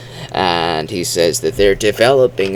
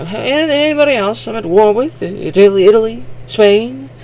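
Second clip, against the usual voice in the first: very high, fast, jokey falsetto voice; squeaky mock commander; 4 dB louder than usual. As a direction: rattle off the enemies in a silly high voice. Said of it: "casual remarks about all the countries".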